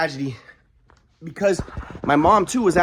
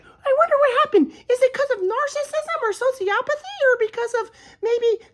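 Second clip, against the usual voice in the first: much higher, mocking know-it-all voice; mocking onlookers; slower than usual. Mocking people who speculate about his family, high falsetto. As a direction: parody people guessing at the cause. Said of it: "people are gonna be like".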